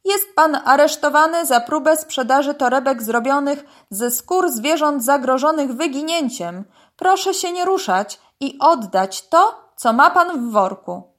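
stern police announcement. A narrator's reading. Police crocodile arrests the python, loud and stern. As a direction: proclaim the charge forcefully.

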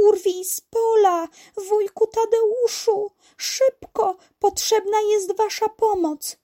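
very high, urgent little-character call; high-pitched, loud summons; calling for help. A narrator's reading. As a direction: call out to faraway friends. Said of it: "Tola na przemian nawoływała".

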